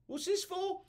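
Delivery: high, baffled squeak; confused peasant. Retold or imitated. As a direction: squeak out a puzzled question. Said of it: "people are like".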